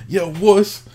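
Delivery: mock Scottish-Yorkshire accent, high and loud; a hammed-up regional accent; bad impression of Niels's accent. Teasing Niels with a bad accent. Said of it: "That was a terrible impression of your accent".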